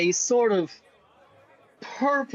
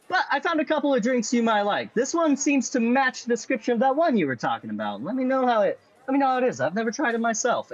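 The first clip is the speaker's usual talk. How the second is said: higher-pitched friendly barkeep; bartender voice; 6 semitones higher, faster than usual. Acted. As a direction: play the chatty bartender, pitched up.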